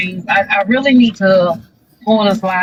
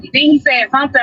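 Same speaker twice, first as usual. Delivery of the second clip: ordinary talk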